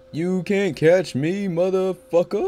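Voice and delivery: taunt. Pretend. Taunting the chaser.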